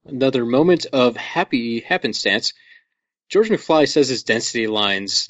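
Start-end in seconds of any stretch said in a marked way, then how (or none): none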